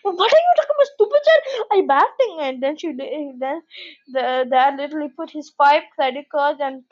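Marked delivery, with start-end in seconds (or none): 0.0-2.4 high, far-off squeaky voice for the rude dad; mock the dad snapping at his child. Retelling a funny video, mocking the dad's rude squeaky reply. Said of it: "the bad dad just said bad things".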